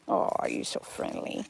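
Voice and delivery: soft breathy whisper to the animal. Real, not acted. Owner whispering tenderly to her friendly goat.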